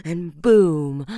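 long villain laugh; maniacal laugh. Acted. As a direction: revel in the kill. Gloating after kill.

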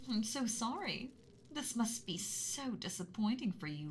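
ordinary talk

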